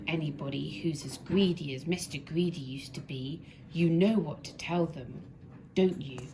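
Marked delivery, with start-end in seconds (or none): none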